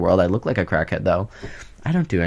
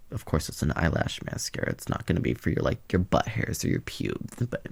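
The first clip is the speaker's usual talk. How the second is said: unvoiced, softer, lower whisper; whispered aside; 4 semitones lower, 10 dB quieter than usual. Real, not acted. Vlogger whispering a cheeky aside about pubes. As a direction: whisper it like a naughty secret.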